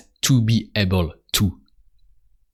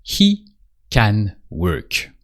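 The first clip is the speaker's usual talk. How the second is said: slow, drawn-out prompt for the learner; slow drill line; much slower than usual. Shown as a demonstration. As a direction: say it slowly so they can repeat. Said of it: "can you say".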